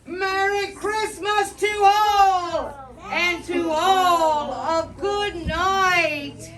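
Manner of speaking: loud, slow, drawn-out farewell call; exclaiming Santa. Acted. Santa calling goodnight from his sleigh, loud and slow. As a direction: call it out big and slow as he leaves. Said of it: "I heard him exclaim".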